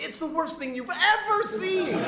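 high, slow, laughing disgust. Retold or imitated. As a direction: laugh out the horror. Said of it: "They're like".

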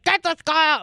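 a hint of a fed-up dad; angry dad. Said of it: "He's like".

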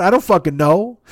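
ordinary delivery, nothing marked

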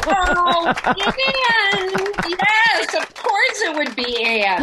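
high, loud, drawn-out real delight; delighted recognition. Real, not acted. Guest learns an old friend was the producer, delighted exclamation. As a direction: exclaim it with a big rush of recognition.

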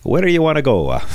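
high-pitched, hammed-up Italian accent; outrageous mock Italian accent; thick Italian accent. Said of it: "outrageously thick Italian accent".

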